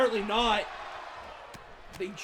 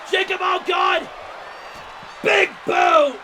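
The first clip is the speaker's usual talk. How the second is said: loud, higher startled yell; shocked shout; 7 semitones higher, 6 dB louder than usual. Real, not acted. Commentator to viewers, shouting at the big boot.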